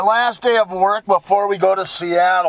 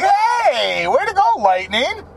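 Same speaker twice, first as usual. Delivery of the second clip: cheering Lightning; 8 semitones higher than usual. Retold or imitated. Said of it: "It's like".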